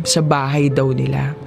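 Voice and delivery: usual speaking voice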